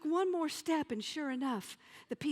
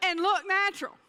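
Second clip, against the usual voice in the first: loud punchline; 6 semitones higher, 9 dB louder, faster than usual. Real, not acted. Comedian hitting the punchline to the audience, loud and high. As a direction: belt it out, pitched up.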